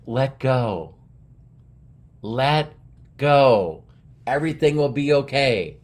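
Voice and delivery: lightly voiced heavenly voice; spaced-out, measured words from God above; voice from the clouds. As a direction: speak as God, pausing between words. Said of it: "a voice come out of the crowd, cl-, uh, clouds".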